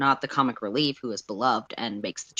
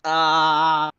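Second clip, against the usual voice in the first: mock blank; 5 dB louder than usual. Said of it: "like, just".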